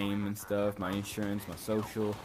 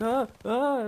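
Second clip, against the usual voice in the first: two short high, louder cries; mock panicked scream; 13 semitones higher, 5 dB louder than usual. Injured skater re-enacts screaming over his broken leg, mock panicked scream. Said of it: "I just screamed because I'm freaking out".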